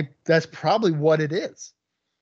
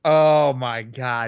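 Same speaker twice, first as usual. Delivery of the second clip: stunned disbelief; 6 dB louder, much slower than usual. Real, not acted. Reacting to silly show name.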